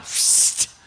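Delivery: a sharp unvoiced hiss to get attention; whispered psst. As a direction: psst at him across the seat. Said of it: "He doesn't want to talk to him".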